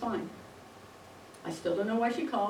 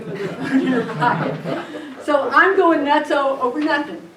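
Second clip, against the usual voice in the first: laughing; 4 semitones higher, 8 dB louder, slower than usual. Real, not acted. Laughs at the butt dial.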